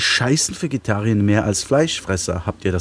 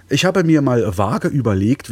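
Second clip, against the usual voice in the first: normal talking voice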